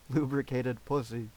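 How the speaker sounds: usual speaking voice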